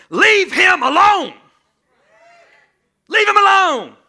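thundering, slow command from heaven; booming voice of God. Acted. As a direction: shout it down from the throne. Voice of God rebuking accuser.

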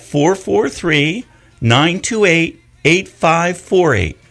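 slow, clear number reading; slow dictation. Shown as a demonstration. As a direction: read each digit slowly. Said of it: "you can call me".

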